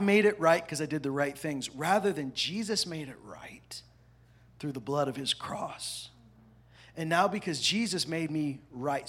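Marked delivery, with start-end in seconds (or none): 2.9-6.1 hushed aside. Real, not acted. Preacher drops to whisper for contrast.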